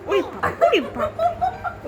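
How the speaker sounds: drawn-out laughing gasp of mock fright; laughing mock alarm. Real, not acted. Mother laughing at child's angry face, mock scared. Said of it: "ওরকম করে?".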